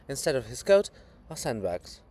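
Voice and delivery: normal talking voice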